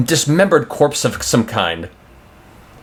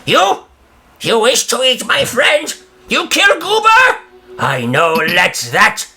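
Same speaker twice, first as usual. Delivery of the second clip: high, frantic goblin shriek; goblin shout; 10 semitones higher, slower than usual. Acted. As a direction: squeal like a scared goblin. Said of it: "lifts a stick defensively and shouts".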